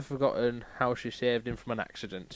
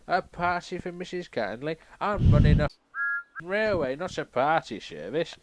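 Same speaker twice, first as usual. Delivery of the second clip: higher and louder indignant rant; grumpy rant; 6 semitones higher, 7 dB louder than usual. Acted. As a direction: scoff at the whole party idea. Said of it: "What I actually said was".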